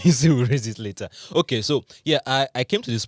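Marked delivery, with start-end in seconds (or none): 0.0-1.1 fast, loud, giggling echo; laughing. Real, not acted. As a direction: laugh while repeating her line. Laughing, echoing her line.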